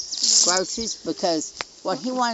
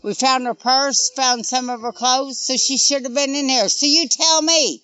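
higher and louder, indignant; heated and upset; 7 semitones higher, 5 dB louder than usual. Real, not acted. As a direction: press every point, fed up. Mother's angry recounting, raised voice.

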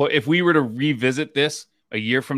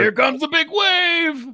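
high, drawn-out comic holler; mock shouted warning; 13 semitones higher, slower than usual. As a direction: holler it out, stretching the words. Acts out the retold sketch.

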